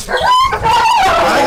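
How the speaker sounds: very high cry of pain; pained shout. Real, not acted. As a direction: yelp as the dog bites. Dog biting the handler.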